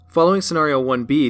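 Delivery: ordinary talk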